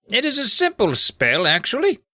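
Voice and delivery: high, put-on wizard voice; explaining wizard. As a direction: play the old-world wizard explaining his magic.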